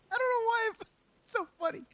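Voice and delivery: high, breaking up with laughter; can barely get the words out for laughing; helpless laughter. Real, not acted. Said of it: "I'm gonna cry".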